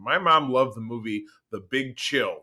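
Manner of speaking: ordinary talk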